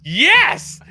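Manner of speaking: high, exultant little cheer; triumphant approval. Retold or imitated. Cheering the shopkeeper's lesson in manners, high and triumphant. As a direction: punch it out high, vindicated.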